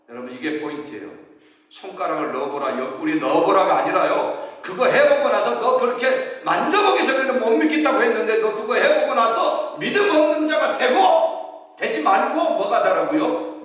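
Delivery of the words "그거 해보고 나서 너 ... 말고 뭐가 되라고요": emphatic preaching, pitch climbing high. Acted. Pastor pressing the congregation with rising emphasis. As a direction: drive the point home, rising.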